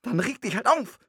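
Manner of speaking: luider, koppig en kortaf. Acted. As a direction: zeg het dwars en uitdagend. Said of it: "sagte sie trotzig".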